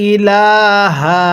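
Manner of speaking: ইলাহা slow, stretching লা by one alif; Quran recitation. Shown as a demonstration. Teacher showing viewers how to stretch ইলাহা, slow recitation. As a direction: draw out the লা of ইলাহা gently, no pull on the hamza. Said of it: "লামের মধ্যে এক আলিফ".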